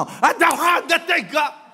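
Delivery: slow, high, shouted protest; angry denial. Acted. Angry person insisting they are not angry, shouted denial. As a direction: bellow each word in mock fury. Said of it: "화를 내는 사람은 자기가 화내는 걸 몰라".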